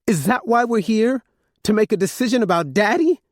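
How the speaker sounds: high squeaky voice near tears; squeaking. A narrator's reading. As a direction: squeeze the words out high. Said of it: "Paris' words squeaked out".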